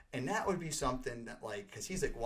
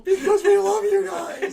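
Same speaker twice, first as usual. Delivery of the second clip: much higher, loud laughing yell; laughing shout; 18 dB louder than usual. Retold or imitated. Fans shouting devotion to rock star, laughing yell.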